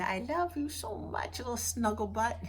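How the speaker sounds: high, cooing affection; doting baby talk. Retold or imitated. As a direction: coo sweetly up high, as to a baby. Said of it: "and I can say".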